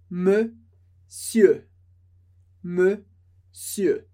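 Monsieur said twice as "msieu", R and N silent; clear, slow model of Monsieur; French pronunciation. Shown as a demonstration. Teacher repeating for learners, careful French model. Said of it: "Sem ler o R, sem ler o O e N".

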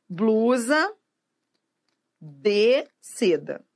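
"blusa de seda" very slow, word by word, with a full "dê"; careful pronunciation. Shown as a demonstration. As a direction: separate each word of "blusa de seda", keeping the e in "de". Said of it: "vou te dar um exemplo".

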